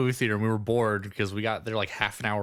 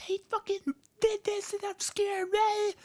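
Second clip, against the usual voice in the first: very high, squeaky mock-child voice; mock scared kid. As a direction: imitate a frightened kid tattling to mom. Scared kid telling his mom.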